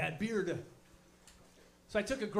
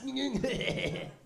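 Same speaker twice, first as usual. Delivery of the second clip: short self-amused chuckle; little chuckle to yourself; 5 semitones higher than usual. Retold or imitated. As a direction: laugh at your own joke. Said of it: "Just chuckling alone".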